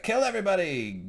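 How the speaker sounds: high-pitched play-acted command; mock kill order. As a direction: mimic a ruler ordering executions. Quotes character ordering killings.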